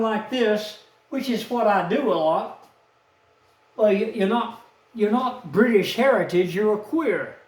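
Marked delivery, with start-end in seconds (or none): none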